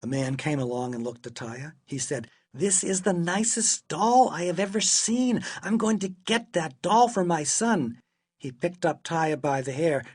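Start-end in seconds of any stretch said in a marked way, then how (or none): 2.5-8.0 higher-pitched, lilting enthusiasm; lightly voiced eager customer; admiring shopper. A narrator's reading. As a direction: gush over the find. Man admires doll to buy.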